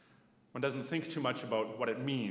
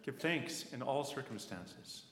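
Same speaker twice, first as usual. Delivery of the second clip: slow, deliberate reading of a verse; scripture recitation; slower than usual. Acted. Preacher opens sermon reciting Paul's verse to congregation, slow and measured. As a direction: lay each phrase down slowly like a blessing.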